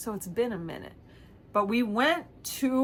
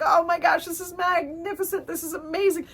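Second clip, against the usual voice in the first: higher, giddy gush; much higher delighted exclamation; thrilled; 8 semitones higher than usual. Real, not acted. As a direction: gasp at the ride's wonder. Thrill at the roller coaster start.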